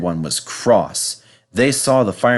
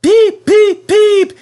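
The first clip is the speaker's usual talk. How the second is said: much higher, loud cheery greeting; cheerful whistle; 9 dB louder, much slower than usual. Acted. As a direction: whistle hello to the boys. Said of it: "he called".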